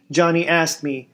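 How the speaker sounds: ordinary delivery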